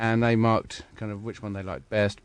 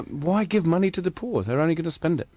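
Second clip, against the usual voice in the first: higher-pitched mock-earnest question and answer; sarcastic; 7 semitones higher than usual. Real, not acted. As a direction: say it with tongue in cheek, pitched up. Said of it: "You're being sarcastic, yeah?".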